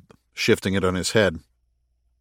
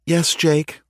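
higher-pitched AI voice; Anubis's AI; 8 semitones higher than usual. A narrator's reading. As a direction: answer as the ship's AI, pitched up. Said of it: "responded Anubis's A I".

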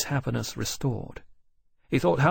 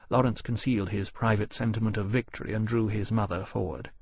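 ordinary delivery

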